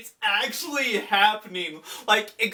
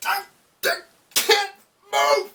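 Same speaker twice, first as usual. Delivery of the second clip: much higher, louder strained cry; panicked cry; 13 semitones higher, 3 dB louder than usual. Retold or imitated. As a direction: cry out, pinned and unable to move. Muzan impaled by blood spikes.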